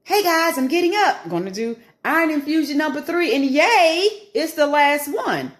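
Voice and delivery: high, bright-pitched upbeat greeting; mock cheerful vlog intro. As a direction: fake chipper hello to viewers. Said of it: "I start at home as proof".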